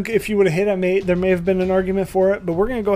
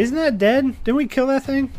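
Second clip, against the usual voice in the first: incredulous; 9 semitones higher than usual. Real, not acted. DM incredulous at familiar.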